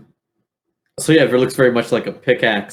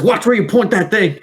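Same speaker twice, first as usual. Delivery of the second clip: sharp yell; 11 semitones higher, 5 dB louder than usual. Acted. NPC Cup yelling at aiming player.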